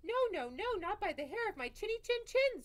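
very high, loud squealing pig; screaming pig. Acted. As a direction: shriek the refusal like a panicked pig. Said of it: "screamed the pig inside".

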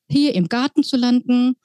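plain reading voice

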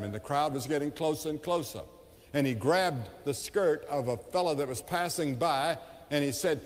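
ordinary talk

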